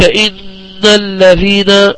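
إن drawn out on نّ for two counts, then on into الذين; ghunnah held two counts. Shown as a demonstration. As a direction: hold the doubled noon of إن in a nasal hum. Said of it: "عند إن مقدار الغنة حركتان".

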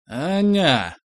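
put-on tongue-tied drunk husband; drunk slurring. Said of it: "еле выговорил он заплетающимся языком". (заплетающимся языком)

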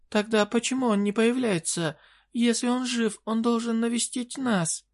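high desperate queen voice; pleading fairy queen. Acted.

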